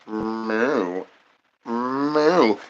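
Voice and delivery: quiet mock cow moo; low soft moo. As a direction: moo like a sleepy bull. Imitated bull noise.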